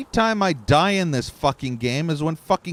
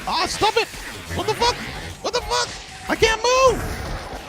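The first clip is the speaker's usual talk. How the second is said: much higher, strangled cries of disbelief; frustrated high yelps. Real, not acted. Gamer stuck among enemies yelps at the screen, much higher. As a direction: yelp as the game traps you.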